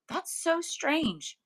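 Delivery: high, slow, wondering mom; mom, amazed. Retold or imitated. Quoting mom's slow amazement at the coincidence, high-pitched. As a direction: draw it out slowly, mom marveling. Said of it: "And she goes".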